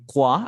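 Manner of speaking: croix said alone as "kwa"; syllable demonstration. Shown as a demonstration. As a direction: isolate croix as one crisp "kwa" syllable. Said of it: "así". (croix como sílaba suelta, "cuá")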